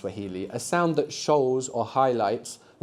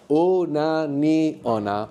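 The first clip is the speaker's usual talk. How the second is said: very slow, each syllable drawn out; 2 semitones higher, much slower than usual. Shown as a demonstration. Teacher breaking the word down for students, very slowly. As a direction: spell the word out by sound, piece by piece. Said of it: "All one word".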